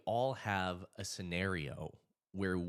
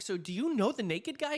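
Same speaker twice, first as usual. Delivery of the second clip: baffled; 6 dB louder than usual. Retold or imitated. Confused question to cop.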